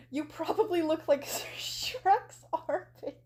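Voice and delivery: cracking up mid-sentence; real laughter breaking in. Real, not acted. Reader losing it at her own insult, laughing. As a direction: try to read on as the giggles take over.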